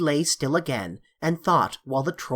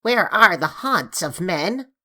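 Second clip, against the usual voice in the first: raised, louder questioning troll; lightly voiced troll asking the way; 4 semitones higher, 4 dB louder than usual. A narrator's reading. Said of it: "repeated the troll".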